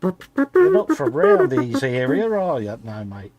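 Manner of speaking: hammed-up Australian bushman, slow; mock Australian yokel. Co-host joking about Aussie locals in a mock outback Australian accent. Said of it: "imitating outback local".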